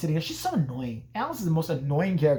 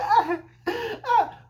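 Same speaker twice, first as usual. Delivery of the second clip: repeated very high falsetto yelps; panicked mock shrieks; 4 dB louder than usual. [name] tying rope to the door, mock shrieks. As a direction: squeal twice in hammed-up panic.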